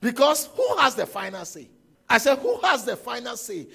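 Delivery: loud, punching the word who; preaching at full force. Real, not acted. Preacher pressing the congregation, loud and forceful. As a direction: demand the answer from the crowd.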